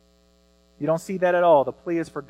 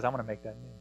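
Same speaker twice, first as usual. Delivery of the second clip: God speaking tenderly; 4 semitones lower, 7 dB quieter, much faster than usual. Retold or imitated. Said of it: "he looks at us and he says".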